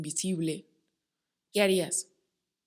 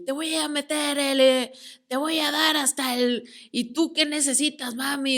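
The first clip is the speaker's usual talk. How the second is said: higher, mocking imitation of dirty reggaeton; mock reggaeton singer; 7 semitones higher, 5 dB louder than usual. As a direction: parody a sleazy reggaeton lyric. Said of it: "escuchando una canción de reggaetón sucio".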